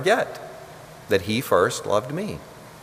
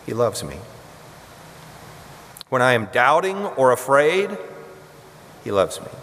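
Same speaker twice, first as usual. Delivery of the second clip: responsive litany; 2 semitones lower than usual. Acted. Preacher leading the congregation's response, hushed. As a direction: lead the church through the litany, answering gently.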